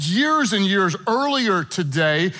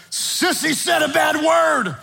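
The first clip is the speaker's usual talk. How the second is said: high, drawn-out tattletale shout; mock-outraged kid voice, pitched way up; tattling little daughter; 8 semitones higher than usual. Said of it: "She was tattling on her sister".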